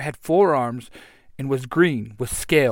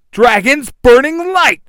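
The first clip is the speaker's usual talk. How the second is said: high, slow, loud attack call; battle cry; 11 semitones higher, 12 dB louder than usual. Acted. Red Ranger setting his blade afire, drawn-out battle cry. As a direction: draw out each word in a shout. Hero calls out a special attack.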